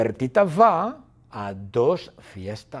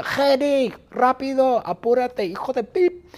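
high, loud urgent holler; high-pitched bossy nagging; impatient yell; 11 semitones higher, 4 dB louder than usual. Acted. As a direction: shout at someone to get moving. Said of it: "Bertita grita".

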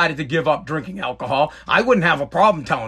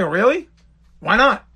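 higher, rising puzzled questions; surprised questioning; 6 semitones higher than usual. Retold or imitated. Host asking why a guest refused, surprised. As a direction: act out being taken aback.

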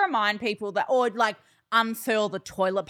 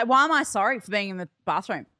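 ordinary delivery

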